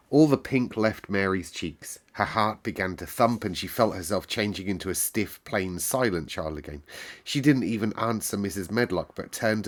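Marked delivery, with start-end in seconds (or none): none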